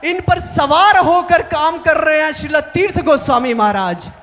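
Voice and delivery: impassioned public tribute, driving the key words; fervent preaching. Real, not acted. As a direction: proclaim it to the whole assembly with conviction.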